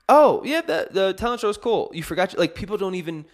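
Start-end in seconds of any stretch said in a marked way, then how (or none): 0.1-1.9 high, loud, breezy brush-off; dismissive classmates. Retold or imitated. Mimicking classmates who barely remembered the show, high and casual. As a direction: toss it off like it barely mattered.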